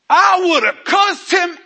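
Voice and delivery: high, loud, drawn-out outburst; mocking indignant yell. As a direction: bellow it slowly in mock outrage. Mocks indignant Christians.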